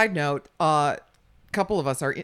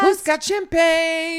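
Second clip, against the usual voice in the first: excited; 9 semitones higher, 8 dB louder, much slower than usual. Real, not acted. Excited exclamation.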